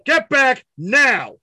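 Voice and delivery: high, slow, forceful command; shouted final warning. Acted. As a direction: shout each word as a last warning. Cop's final order before gun.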